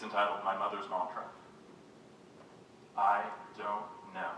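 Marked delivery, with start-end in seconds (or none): 3.0-4.3 slow, effortful "I don't know"; halting words. Acted. Poet voices mother after strokes, "I don't know" slow and halting. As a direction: draw out each word of "I don't know". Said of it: "each sound on its own".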